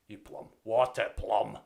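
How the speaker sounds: What a plum in a hammed-up Scottish accent; mock Scottish insult. Streamer showing off Scottish insults, mock Scottish accent. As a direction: bark the insult like a Scot. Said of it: "in the Scottish accent".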